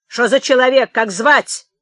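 loud, high interrogation; thundering. A narrator's reading. Clerk interrogating the tramp, thundering. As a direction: thunder the question out. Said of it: "грянул писарь".

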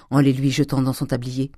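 usual speaking voice

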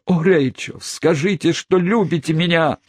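high, passionate plea. Acted. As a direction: beg her to say it.

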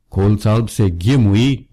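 ordinary delivery, nothing marked

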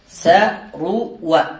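ثروى drawn out slowly, each syllable sa-ru-wa apart; slow spelled-out word. Shown as a demonstration. Teacher models ثروى on its pattern for students, slow and spelled out. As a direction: stretch ثروى out so the class hears every syllable. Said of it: "على وزن فعل".

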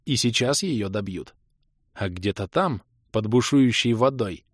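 usual speaking voice

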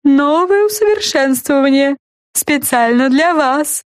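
high, proud slow voice; talking lollipop. A narrator's reading. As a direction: show it off proudly. Lollipop shows off.

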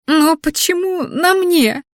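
high, slow frightened whine; nervous bleat. A narrator's reading. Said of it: "нервно заблеяла Криштия".

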